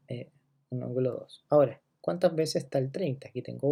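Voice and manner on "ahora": usual speaking voice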